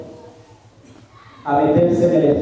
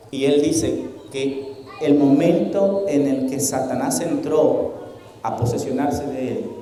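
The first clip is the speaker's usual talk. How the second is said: usual speaking voice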